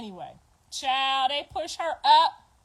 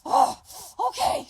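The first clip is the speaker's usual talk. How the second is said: high squeaked okay; a quick high imitation of her; mock laboring girl; 7 semitones higher, 5 dB louder than usual. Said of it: "So she's like".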